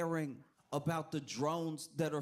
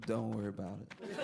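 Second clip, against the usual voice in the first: dismissive official; 6 semitones lower than usual. Retold or imitated. Acting out government's dismissive answer.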